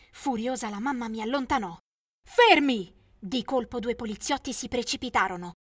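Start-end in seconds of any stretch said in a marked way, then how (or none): 2.2-2.9 loud barked order; police storming in, shouting an order. Acted. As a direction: stop everyone with one loud word. Police burst in.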